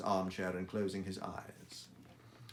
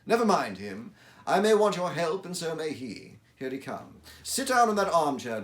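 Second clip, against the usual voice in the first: louder, higher commanding tone; Holmes voice; 7 semitones higher, 9 dB louder than usual. Acted. Holmes tells Watson to sit, loud and brisk. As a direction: briskly settle your friend before the knock.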